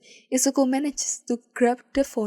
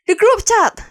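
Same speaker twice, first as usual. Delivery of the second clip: high, very loud shout; man shouting a sudden idea, high and loud; 10 semitones higher, 13 dB louder than usual. A narrator's reading. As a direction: blurt it out at full volume. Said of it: "shouts the man beside Izuku".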